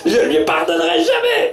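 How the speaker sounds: very high squeal through laughter; laughing mock outrage. Disciple swearing never to forgive mother-in-law, laughing squeal. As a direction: refuse with comic outrage.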